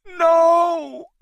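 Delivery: very high, loud cry. A narrator's reading. Anne seeing Kong attacked, a very high loud cry. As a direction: scream it in horror. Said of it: "Anne cried".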